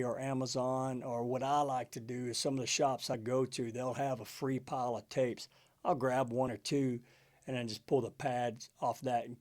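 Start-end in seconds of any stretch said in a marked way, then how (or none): none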